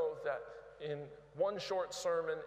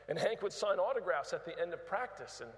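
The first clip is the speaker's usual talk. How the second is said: ordinary talk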